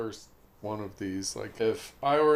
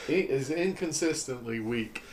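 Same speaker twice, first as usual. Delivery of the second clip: usual speaking voice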